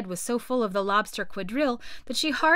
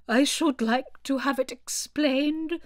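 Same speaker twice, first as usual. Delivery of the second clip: Mock Turtle voice; 4 semitones higher, 3 dB louder, slower than usual. A narrator's reading.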